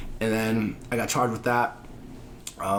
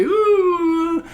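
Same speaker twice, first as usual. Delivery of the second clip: ordinary talk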